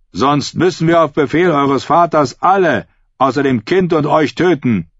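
erhöhte, kräftige Drohung; rufender Hauptmann. A narrator's reading. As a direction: ruf die Warnung hinüber. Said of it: "rief der Hauptmann". (drohender Zuruf eines Hauptmanns)

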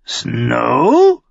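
high, loud raven croak. A narrator's reading. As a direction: croak it like a bird. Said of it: "krächzte er".

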